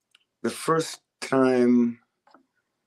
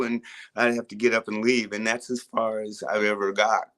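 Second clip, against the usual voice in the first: ordinary delivery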